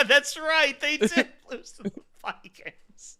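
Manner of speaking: laughing through the words; laughing triumphantly. Real, not acted. Laughing at rival fans.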